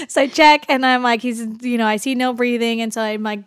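usual speaking voice

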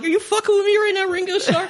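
high, fast, laughing disbelief; mock incredulous. Retold disbelief at the star's question.

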